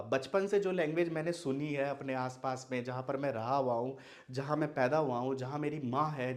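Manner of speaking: usual speaking voice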